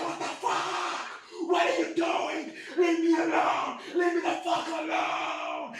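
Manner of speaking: slow-stretched, strained shouts; imitated yelling out of a car window; desperate mock yelling. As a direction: plead at a held-back scream, dragging out the last words. Yells at pursuers from car window.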